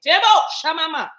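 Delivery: sudden shouted interjection; spirit exclamation. Real, not acted. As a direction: blurt the sacred syllables. Sudden spirit exclamation mid talk.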